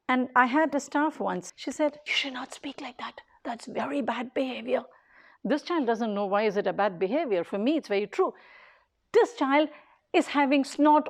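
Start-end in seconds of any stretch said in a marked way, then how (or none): 2.1-4.8 hushed scolding. Retold or imitated. Staff scolding a child.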